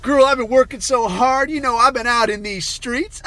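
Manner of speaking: high, loud hammed-up girl-talk; Guerrilla Harris impression. Bragging to the interviewer, mocking high voice. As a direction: push it high and loud, mock chummy. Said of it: "she's talking like this".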